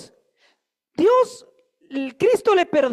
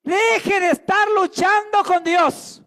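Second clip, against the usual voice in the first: higher, louder, slow pleading to a brother; slow, raised plea: stop fighting God; preaching exhortation; 6 semitones higher, 6 dB louder than usual. Shown as a demonstration. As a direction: urge the person beside you, slowly and forcefully. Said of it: "Dígale".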